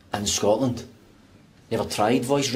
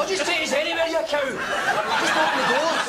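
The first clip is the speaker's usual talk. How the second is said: very high, squealed exasperation; exasperated outburst. Acted. Trapped passenger yelling at the lift to open the doors, frantic and high-pitched. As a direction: lose your rag, pitch shooting right up.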